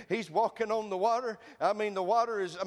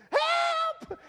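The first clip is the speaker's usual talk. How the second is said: high squealing yell of Help; shouted cry for help; 4 dB louder than usual. Shown as a demonstration. Preacher acting Peter's cry in the storm, high yell. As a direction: scream it high like you're going under. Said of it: "cry what you feel".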